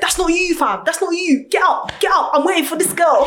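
a hint of a cocky London guy calling her out; London accent. Angry man confronting his catfish date, light London accent. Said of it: "he was proper, like, Londoner".

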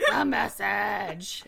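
very slow, loud gurgling croak; gurgled message. Acted. Dying messenger gurgles at Fitz, slow loud croak. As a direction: drag the words out, choking. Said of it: "she just gurgles".

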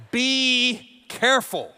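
slow, pointed "careful" warning; drawn-out warning. Retold or imitated. Wife warns her fallen husband, slow and pointed. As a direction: stretch out "Be careful" slowly. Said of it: "she commenced to saying".

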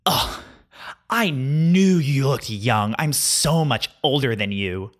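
put-on slow, drawn-out condescending girl voice; smug mock girl. Gym girl bragging she's older, slow and smug. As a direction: stretch it out like you're looking down on him. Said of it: "She goes".

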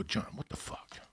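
hissed whisper at the cop; whispered confrontation. Retold or imitated. Confronting John away from his daughter, whispered. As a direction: keep it low so the kid won't hear.

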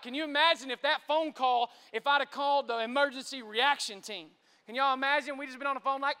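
usual speaking voice

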